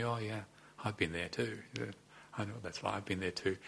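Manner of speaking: imitating a teacher's soft, understated reply; quiet reassurance. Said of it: "he just quietly says to me".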